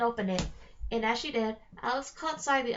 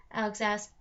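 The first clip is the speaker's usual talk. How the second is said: usual speaking voice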